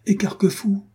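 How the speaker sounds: sharp, high call of recognition; excited cry. Acted. Armand Louis spots the squire, exclaiming in high surprise. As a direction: exclaim the name brightly. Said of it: "s'écria monsieur de la Guerche".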